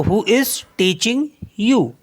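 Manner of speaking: slow, clear and word by word; slow model sentence. Shown as a demonstration. Teacher modelling the sentence for students, slow and careful. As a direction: spell it out slowly for learners. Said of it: "आपको कौन पढ़ा रहा है".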